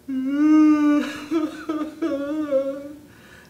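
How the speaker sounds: high whimpering wail breaking into sobs; hammed-up whimper, trailing in shudders; dog's wail. Dog cries seeing ham eaten.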